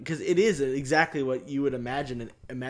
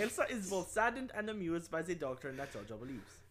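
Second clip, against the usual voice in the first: put-on German accent; 8 dB quieter than usual. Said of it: "the German accent".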